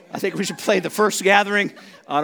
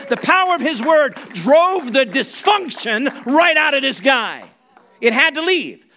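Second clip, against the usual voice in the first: high, forceful sermon delivery building louder; full-voiced preacher hammering key words; impassioned preaching; 7 semitones higher, 5 dB louder than usual. Real, not acted. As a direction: preach it with mounting power.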